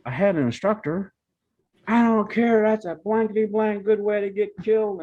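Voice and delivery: imitating a dad yelling and cussing; furious father. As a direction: rant at your son like a furious father. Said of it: "kept cussing and going".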